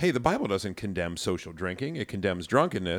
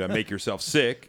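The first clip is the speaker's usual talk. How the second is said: ordinary talk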